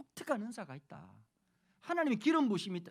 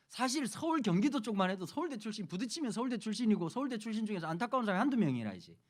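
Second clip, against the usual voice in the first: ordinary talk, nothing marked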